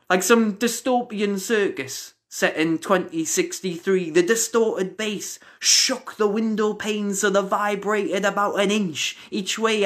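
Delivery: dramatic poem delivery with long pauses; performance poetry. Acted. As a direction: build the image high, then drop it word by word. Poem builds, then winds down.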